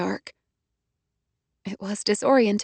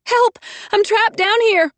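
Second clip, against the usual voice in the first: high, loud desperate cry; shout for help; 13 semitones higher, 5 dB louder, slower than usual. A narrator's reading. Trapped in the flooded elevator, loud desperate shout. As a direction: yell up the shaft for rescue.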